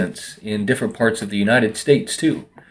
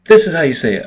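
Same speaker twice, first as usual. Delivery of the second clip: imitated correction, higher and louder; pitched-up corrective quote; insistent correction; 6 semitones higher, 5 dB louder than usual. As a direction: mimic a friend insisting on pronunciation. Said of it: "she'll tell me".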